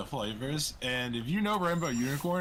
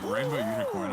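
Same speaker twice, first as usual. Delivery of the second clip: high squeaky mock answer, much higher pitch; incredulous squeak; 3 dB louder than usual. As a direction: squeak the flavor name in disbelief.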